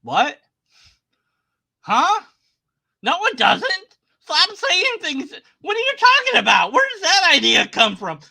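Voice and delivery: high, loud disbelief; a high, loud and rising real "what?"; incredulous. Real, not acted. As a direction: react like you can't believe what you just heard. Disbelief at the clip's claim.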